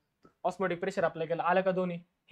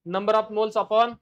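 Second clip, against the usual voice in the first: dictating each word slowly for notes; slow dictation; 2 semitones higher, 5 dB louder, much slower than usual. Shown as a demonstration. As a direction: spell the definition out slowly so students can write. Dictates formula.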